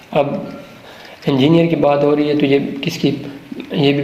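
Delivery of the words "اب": usual speaking voice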